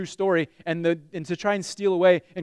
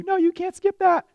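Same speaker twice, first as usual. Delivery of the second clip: put-on high child's voice, much higher; three-year-old voice; 12 semitones higher, 4 dB louder than usual. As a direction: squeak it like a small child. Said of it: "my three-year-old ... he'll tell you".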